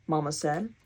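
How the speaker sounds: plain reading voice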